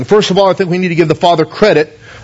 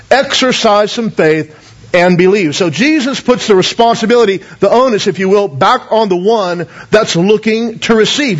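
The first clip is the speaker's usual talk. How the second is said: ordinary talk, nothing marked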